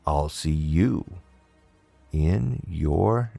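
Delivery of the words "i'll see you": ordinary delivery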